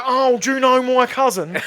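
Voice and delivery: mock eager fan. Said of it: "'Cause they go".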